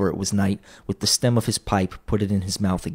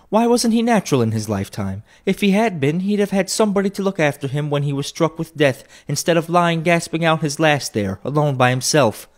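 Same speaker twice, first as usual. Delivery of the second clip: brazen woman; 8 semitones higher than usual. A narrator's reading. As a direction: chide her for staring. First woman voiced.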